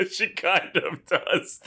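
high, slow words through laughter; cracking up while conceding it; laughing. Real, not acted. Cracking up at the Lisa comparison.

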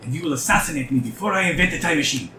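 a lightly voiced mad scientist; eccentric doctor voice. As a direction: lightly play the eager inventor. GM voices the Mind Flayer doctor.

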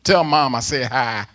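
loud, high proclaiming of Paul's words; booming apostle's greeting; preaching Paul's greeting. Retold or imitated. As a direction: shout it like Paul greeting his mother. Preacher voicing Paul again.

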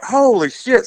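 high, drawn-back mock amazement at normal volume; retold astonishment. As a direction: imitate a friend's stunned realization. Friend realizes the long detour.

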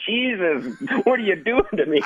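exasperated co-host. Retold or imitated. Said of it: "he's like".

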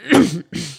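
quick gruff throat clear. Acted. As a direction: clear the throat before speaking. King about to speak.

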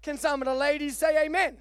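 high, loud preacher delivery; impassioned preaching. Real, not acted. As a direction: preach it hard, driving each phrase home.

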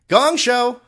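loud high title call; game show announcer. Pretend. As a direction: belt it like a TV announcer.

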